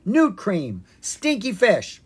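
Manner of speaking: high, loud back-and-forth; bickering. Acted. Argument escalating.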